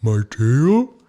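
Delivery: a timid whale, almost whimpering; pitiful whale. A narrator's reading. Ooga asks Snow Bear about his tail, pitiful. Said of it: "asked Ooga pitifully".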